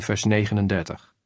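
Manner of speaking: ordinary delivery, nothing marked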